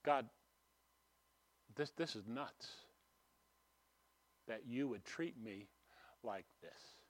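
soft, low muttered grievance to God; hushed, low private complaint; inner complaint. Retold or imitated. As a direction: grumble quietly at God under your breath. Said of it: "in my heart, I'm saying".

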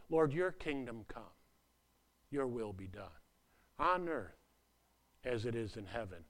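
soft, low, reverent prayer; hushed reverent prayer; prayerful hush. Shown as a demonstration. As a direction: pray it quietly, from the heart. Quoting Lord's Prayer prayerfully.

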